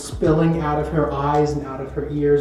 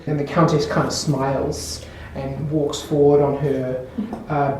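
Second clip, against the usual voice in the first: usual speaking voice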